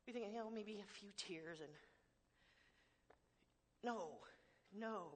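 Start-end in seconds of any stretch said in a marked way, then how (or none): none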